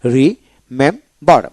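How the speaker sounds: Remember slowly in three separate syllables; split into syllables. Shown as a demonstration. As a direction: break remember into ri, mem, bar with clear gaps. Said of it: "এখানে তিনটা syllable হলো".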